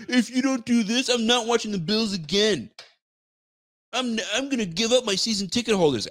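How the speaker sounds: high, whiny mock fan voice. Streamer mocking fans who threaten to drop tickets, whiny high voice. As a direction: parody a fan threatening to quit.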